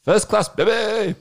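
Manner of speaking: high, slow triumphant cheer; excited. Real, not acted. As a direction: crow about the win. Bragging triumph.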